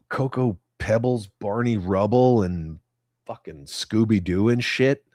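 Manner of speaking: ordinary delivery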